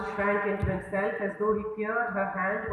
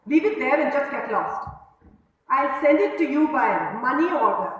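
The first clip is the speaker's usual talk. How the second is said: roaring; 5 semitones higher, 6 dB louder than usual. Acted. Saugandhi roars at Madhav to leave his cap, much louder. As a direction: roar him out of the room. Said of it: "Saugandhi roared".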